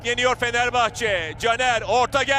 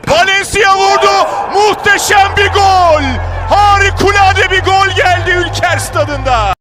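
full-throated ecstatic goal call; screaming football commentator; 11 dB louder than usual. Real, not acted. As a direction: roar it over the crowd. Commentator ecstatic over a great goal. (coşkuyla haykıran maç spikeri)

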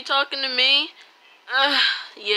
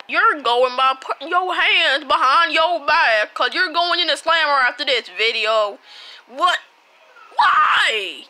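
louder, bossy mock police officer; hammed-up arrest, barking orders; playful cop arrest; 6 semitones higher, 8 dB louder than usual. Pretend cop arresting a player, mock stern.

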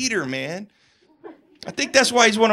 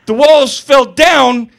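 ordinary talk

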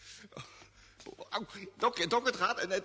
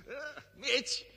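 high calls into the dark; calling out; 5 semitones higher, much slower than usual. Acted. Jimmy calls to Mitch in the dark room. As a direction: call his name across the room.

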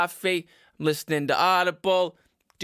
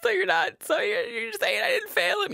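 much higher mom voice; a mom checking she is off the hook; hopeful mother. Acted. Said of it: "worrying my parents".

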